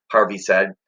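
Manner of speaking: usual speaking voice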